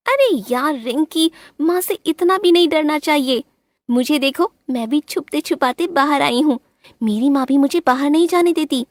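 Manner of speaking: high playful coaxing bird voice; friendly bulbul. A narrator's reading. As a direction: tempt your friend out to play. Bulbul coaxes.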